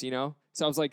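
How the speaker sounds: ordinary talk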